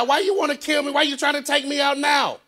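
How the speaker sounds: high, loud pleading shout; crying out. Retold or imitated. Hezekiah pleading with the Lord for his life, crying out. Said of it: "And he cried".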